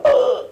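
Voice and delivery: high loud choking gasp; imitated gasp. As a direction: gasp for air. Imitating blocked breathing.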